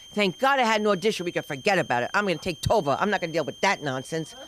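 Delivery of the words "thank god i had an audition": ordinary talk, nothing marked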